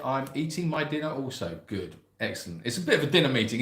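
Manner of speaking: usual speaking voice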